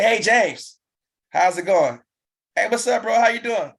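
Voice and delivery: high, loud, bubbly hello to a friend; excited phone greeting. Shown as a demonstration. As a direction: greet James like you're thrilled to call. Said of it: "hear the excitement in my voice".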